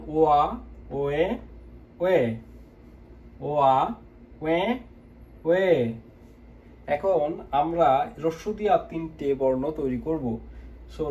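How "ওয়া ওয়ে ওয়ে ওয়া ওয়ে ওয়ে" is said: ওয়া and ওয়ে each clearly separated; vowel drill. Shown as a demonstration. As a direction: drill each syllable for learners. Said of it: "আমরা লিখলাম". (উচ্চারণ অনুশীলন)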